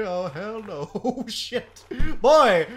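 normal talking voice

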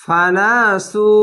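ফানাসু slow, with the final u held; drawn-out recitation. Shown as a demonstration. Teacher reading the word to students, drawn out. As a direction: stretch the last vowel of ফানাসু. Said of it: "এক আলিফ টেনে পড়বেন".

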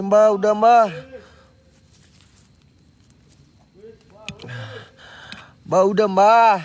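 loud call across; shouting. Real, not acted. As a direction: yell for him to come back. Calling out to Mbah again.

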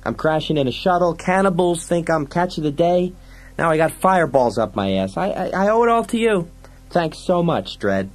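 high, agitated sarcastic rant; whiny, aggrieved sidekick. Acted. Fergie blames Dredd for his misery, high sarcastic rant. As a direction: complain in a high, worked-up voice.

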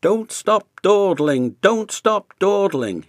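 high, loud grumbling chant; grumbling engine. A narrator's reading. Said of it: "he grumbled".

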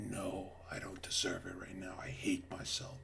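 acted near-whisper, low and defeated; hushed, hopeless inner voice; whispered self-loathing. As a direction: whisper it as a private confession. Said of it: "and you'll say".

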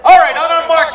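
normal talking voice

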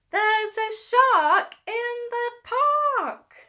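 high, loud, slow alarm shout. Acted. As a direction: yell out the warning. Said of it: "what's he about to shout".

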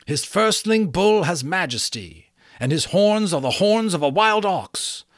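butterfly reciting prophecy urgently; recited. A narrator's reading. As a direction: recite it like scripture.

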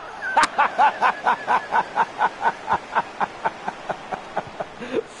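long high wheezing laughing fit. Real, not acted. Streamer laughing at his own jibe, long high laugh. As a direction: crack up for several seconds.

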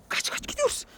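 urgent whisper; whispering while hiding. Pretend. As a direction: whisper it, fleeing quietly. Running from the pretend witch.

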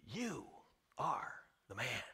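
hushed accusation. Acted. Prophet's quiet accusation.

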